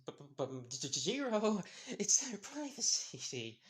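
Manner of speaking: high stammering panic with an awkward laugh; nervous stammer. Acted. Mina pleading with Jiro, nervous stammering. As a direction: stutter out an excuse, sweating bullets. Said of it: "sweating bullets".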